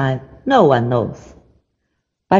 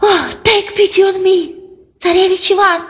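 high, strange gasping fish voice; a stranded fish begging for water; pike voice; 11 semitones higher, faster than usual. Acted. Pike on the shore begs Ivan, high and strange. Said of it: "said the pike".

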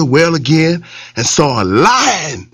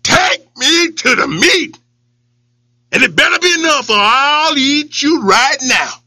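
lion threat; 8 semitones higher than usual. Acted.

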